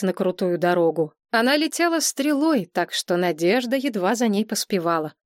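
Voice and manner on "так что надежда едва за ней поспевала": plain reading voice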